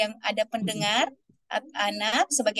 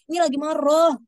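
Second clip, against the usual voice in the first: a hint of anger, louder; mock angry; 4 semitones higher, 6 dB louder than usual. Shown as a demonstration. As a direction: show the kids an angry tone. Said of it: "sesuaikan ekspresi dan nada suara kita". (nada marah pura-pura, lebih keras)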